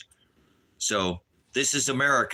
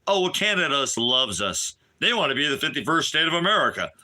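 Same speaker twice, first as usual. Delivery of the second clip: higher, fast, sing-song put-on voice; mocking Trump; 4 semitones higher than usual. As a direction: toss it off like a smug politician. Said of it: "he turns around and goes".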